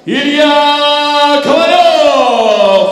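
high, slow, stretched fighter name; booming stage introduction. Acted. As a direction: sing the name out long.

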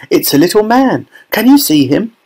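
put-on high, eager child's voice; excited cry. A narrator's reading. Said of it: "cried Charlie".

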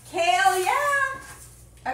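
long, drawn-out, loud, high cheer; kale yeah cheer. Pretend. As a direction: belt it out like a catchphrase. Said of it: "I've never heard that one before".

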